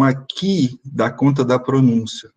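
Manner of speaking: usual speaking voice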